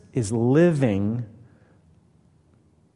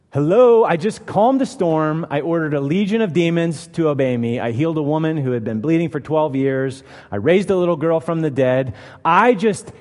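higher and louder, an indignant "Hello"; castigating rebuke; 4 semitones higher, 4 dB louder than usual. Retold or imitated. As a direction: scold them like a frustrated teacher. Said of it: "probably could have castigated them".

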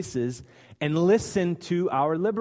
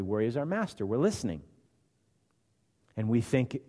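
usual speaking voice

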